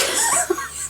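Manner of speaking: a sudden high giggle; high squeaky laugh. Real, not acted. Knitter laughs that the dog's ball no longer squeaks, high giggle.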